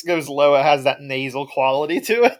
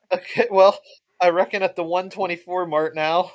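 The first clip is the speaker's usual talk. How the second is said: ordinary delivery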